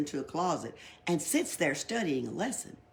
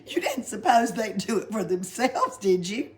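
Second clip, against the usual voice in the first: laughing; 3 semitones higher, 7 dB louder than usual. Acted. Pippi's joke, laughing.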